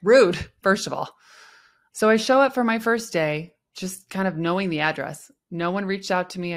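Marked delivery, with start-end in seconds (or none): 0.0-1.2 mock offended. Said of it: "I was like".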